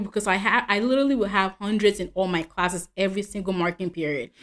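ordinary talk, nothing marked

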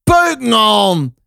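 hoge, langgerekte roep die daalt; boze uitroep. A narrator's reading. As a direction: roep het hoog en gerekt door de kamer. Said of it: "riep Shaila". (langgerekt uitgeroepen)

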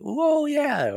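high excited whoa; squeaky mock cheer; kid cheering. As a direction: cheer like a kid at a Beyblade match. Kid cheering Beyblade battle.

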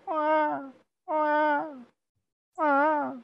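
put-on very high wailing baby cries; baby crying. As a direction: wail like a small baby. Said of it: "and you can hear".

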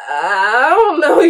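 slow, loud, breaking into laughter; can't hold it together while denying; nervous laughing denial. Retold or imitated. Girl answering her dad, slow and loud, cracking up.

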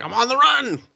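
high-pitched cartoon voice for the talking chair; squeaky chair voice. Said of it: "his chair's just like".

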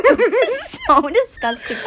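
high laughing disgust. Real, not acted. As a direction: squeal it through a laugh. Laughing at gross memory.

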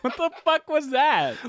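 high baffled outburst; stunned at the weird laugh, baffled. Real, not acted. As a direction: ask in bewildered disbelief. Reacting to odd laugh.

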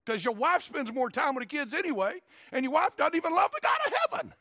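high, loud preaching yell; fiery pulpit scolding; full-force rebuke. Real, not acted. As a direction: build to a strained shout of indignation.

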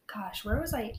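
breathy whisper to herself, losing the thread; whispered aside. Real, not acted. To her friends, losing her place in the story: whispered aside. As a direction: murmur it under your breath, searching for the thread.

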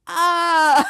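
loud, drawn-out oh; mock the penny dropping, big and long; exaggerated realization. Boss's second, dawning reaction retold.

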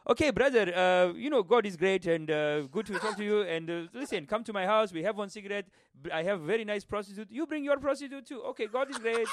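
hammed-up Arabic-accented oil-tycoon rich kid; mock Arabic accent. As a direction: play a rich caller with an exaggerated Arabic accent. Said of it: "oil tycoon rich kids who are like".

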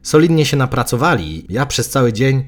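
plain reading voice

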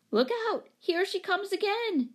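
high-pitched excited warning; gasping alarm. A narrator's reading. Woman with a broom seeing the cat return, gasping. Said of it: "gasped the woman with the broom".

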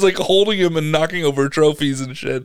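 normal talking voice

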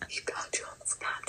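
shocked whisper. Real, not acted. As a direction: hush it like a secret. Shocked gossip between friends.

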